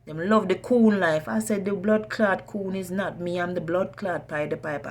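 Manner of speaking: usual speaking voice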